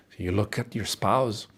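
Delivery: ordinary delivery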